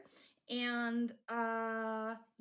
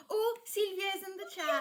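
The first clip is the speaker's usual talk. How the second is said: high, loud and delighted; excited; 9 semitones higher, 6 dB louder than usual. Real, not acted. Streamer spotting a friend in chat, thrilled and loud. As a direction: squeal at a friend's name.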